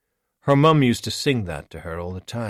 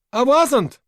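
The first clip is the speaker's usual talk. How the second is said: much higher, much louder reply; shouted denial; 11 dB louder than usual. Acted. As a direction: call the lie back across the dark. Yelling back to the hut.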